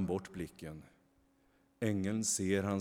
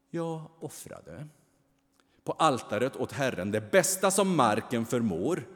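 higher, louder aggrieved voice; Kain's bitter complaint; 4 semitones higher, 6 dB louder than usual. A narrator's reading. Kain pleads bitterly to the angel, aggrieved. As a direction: plead his case to the angel.

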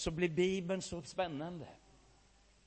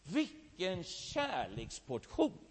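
savoured wonder; 5 dB quieter, much slower than usual. Retold or imitated. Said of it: "sa han".

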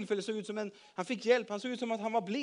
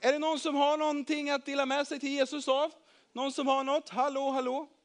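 raised, higher calling voice; 6 semitones higher than usual. A narrator's reading. Andreas asking the crowd for food, calling out. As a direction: call across a crowd for an answer.

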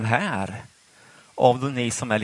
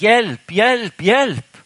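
high, loud desperate shout; a drowning man's loud, high cry for help; acted cry for help; 10 semitones higher, 7 dB louder, much slower than usual. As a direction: call out as if drowning. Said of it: "du ligger där och skriker".

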